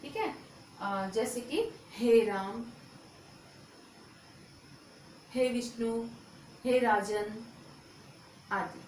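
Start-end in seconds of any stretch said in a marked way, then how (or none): 1.9-7.5 हे राम, हे विष्णु, हे राजन with the final vowel drawn out as a call. Shown as a demonstration. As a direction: stretch the vowel at the end of राम, विष्णु, राजन like calling someone. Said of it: "किसी को पुकारने के लिए".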